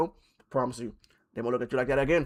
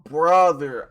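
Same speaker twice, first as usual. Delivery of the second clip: ordinary talk, nothing marked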